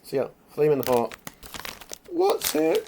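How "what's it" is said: surprised squeak. Real, not acted. Reading a snack packet.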